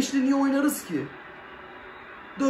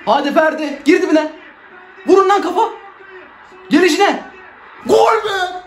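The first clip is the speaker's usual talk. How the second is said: real full-throated cheering, pitch high; goal celebration shout; 8 semitones higher, 8 dB louder than usual. Real, not acted. Fan watching the match cheers the goal, real ecstatic shouting. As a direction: yell at the screen as the ball goes in.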